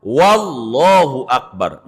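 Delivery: Wallahu Akbar with an added w at the start; wrong leading waw. Shown as a demonstration. Teacher demonstrating the forbidden waw up front. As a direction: put a w before Allahu. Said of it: "nambahin waw di depan".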